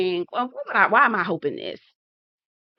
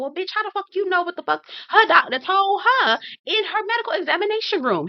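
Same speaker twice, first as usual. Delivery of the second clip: high-pitched, rapid disbelief; high, indignant rant; incredulous outburst; 10 semitones higher than usual. Real, not acted. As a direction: challenge her claim, voice shooting up. Incredulous rebuttal to quoted claim.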